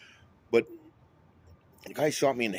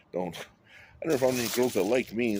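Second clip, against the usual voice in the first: ordinary talk, nothing marked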